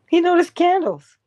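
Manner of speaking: a high, loud burst of wonder; excited. Real, not acted. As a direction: announce it like a discovery. Marvelling at the inventor.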